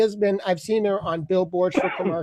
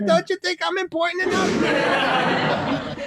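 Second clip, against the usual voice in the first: higher, slower, laughing complaint; mock whine; 5 semitones higher, slower than usual. Caller whining to a sleeping friend in a high mock whine. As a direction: whine that you deserve attention.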